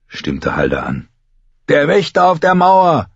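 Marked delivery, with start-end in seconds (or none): 1.7-3.1 high, loud crowed chant; shrill boyish crowing. A narrator's reading. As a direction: crow the line out at the top of the voice. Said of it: "krähte Tod".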